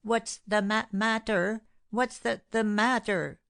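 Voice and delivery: breathless stammer on what's the matter, breaking off; stuttering. Retold or imitated. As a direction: imitate a man too winded to finish. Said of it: "he stuttered like this".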